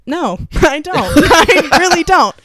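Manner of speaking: high, loud denial through laughter; laughing admission. Retold or imitated. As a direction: laugh while insisting on not knowing. Retold answer, laughing at herself.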